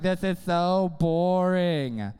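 long, drawn-out complaint; mock boredom. Congregant complaining about a forty-five-minute sermon, mock boredom. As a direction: drag it out like a bored listener.

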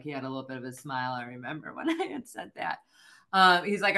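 ordinary delivery

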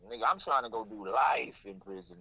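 ordinary delivery

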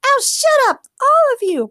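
very high, loud furious yell; angry yell. A narrator's reading. Morgana yelling at the laughing men, furious. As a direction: yell at them to shut up. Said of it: "Morgana yelled".

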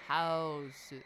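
Hausu drawn out as HOW-su; imitated Japanese pronunciation. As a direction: imitate the film's Hausu, stretched. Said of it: "Yeah, like that".

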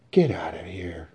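whispered dismissal; hushed order. Acted. Mother to sons after the deserted dinner, hushed order. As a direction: order them out under your breath.

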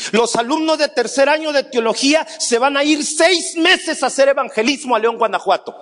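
high-pitched, animated retelling of someone's decree; imitated plan announcer. Retelling someone's plan for the theology students, mock announcement. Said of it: "A alguien se le ocurrió decir".

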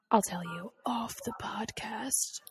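slow secretive whisper. Real, not acted. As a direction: keep it from the listeners. Secret kept from listeners.

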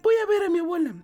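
put-on sweet little girl voice. A narrator's reading. Girl answers the wolf.

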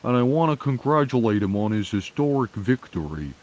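high-pitched mock senator with Vermont accent; put-on accent, pitched well up; Bernie Sanders impression. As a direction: imitate a politician giving formal congratulations. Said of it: "Bernie Sanders says".

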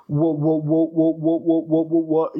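imitating a teacher's cruel stammer; teacher mocking stutter. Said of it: "all of a sudden she goes".

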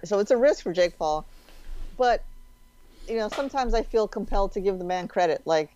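ordinary delivery

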